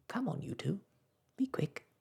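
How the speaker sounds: high, hurried sprite whisper; tiny star voice. Acted. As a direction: hurry them like a bossy sprite. Star urges children.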